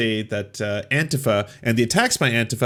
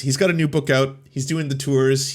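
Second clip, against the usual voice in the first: usual speaking voice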